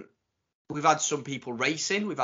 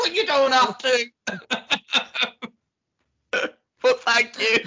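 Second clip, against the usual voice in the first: laughing; 7 semitones higher, 4 dB louder than usual. Real, not acted. Laughing at birthday package.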